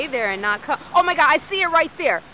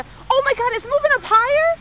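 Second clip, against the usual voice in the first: high, louder burst of amazement; excited; 11 semitones higher, 4 dB louder than usual. Real, not acted. Mother filming the sky, thrilled it moves higher, high excited cry. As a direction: gasp it out as the light climbs.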